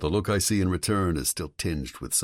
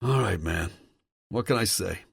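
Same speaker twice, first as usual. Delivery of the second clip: dejected; 4 semitones higher than usual. Acted. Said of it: "he says dejectedly".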